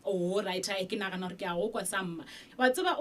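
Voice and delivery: ordinary talk, nothing marked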